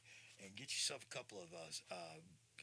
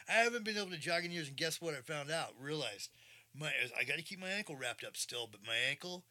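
excited; 6 semitones higher, 9 dB louder than usual. Real, not acted. Joyful news about jogging again.

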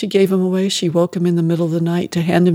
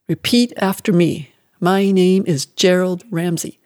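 cold drilling mother; 4 dB louder than usual. Acted. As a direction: spell out each word like an order. Mother drilling the new name.

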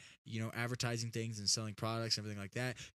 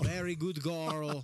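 slow, louder, higher mock Russian; stretched-out Russian accent pet praise; 5 semitones higher, 6 dB louder, much slower than usual. Praising dog Stella, drawn-out mock Russian accent. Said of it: "can't turn on the Russian accent off".

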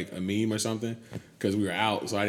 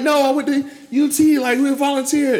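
high, loud whiny protest; whiny girl voice; 12 dB louder than usual. Retold or imitated. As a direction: mimic a whiny defensive friend. Imitating Whitney's protest.

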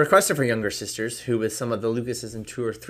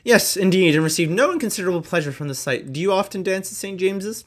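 put-on higher, louder fawning old gentleman; Sir William voice; 9 semitones higher, 5 dB louder than usual. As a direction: gush over the compliment.

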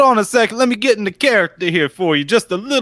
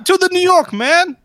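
put-on shifting New York accent; 7 semitones higher than usual. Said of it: "now you're shifting".

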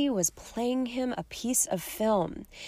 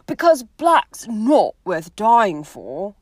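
slow Ron warning; 2 semitones higher, 7 dB louder, slower than usual. Acted. Ron's blunt warning.

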